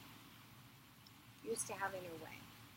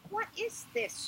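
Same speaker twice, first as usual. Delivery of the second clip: sharp, much higher outraged cry; incensed; 14 semitones higher than usual. Acted. Inanna outraged as the crown is taken, high angry cry. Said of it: "She was incensed".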